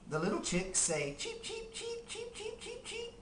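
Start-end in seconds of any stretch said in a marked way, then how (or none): 1.2-3.1 very high, soft cheeping; chick cheep. Pretend. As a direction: peep like tiny chicks. Chick sound.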